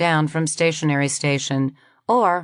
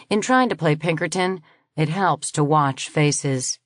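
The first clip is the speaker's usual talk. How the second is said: usual speaking voice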